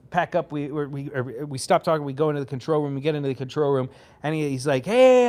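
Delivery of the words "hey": cheery hey. Retold or imitated. Drummer greets the room.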